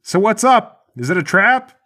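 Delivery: high, dopey-eager yell to a partner; yelled question. Acted. Guard calling to his partner round the corner, yelling. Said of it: "The other guard yells".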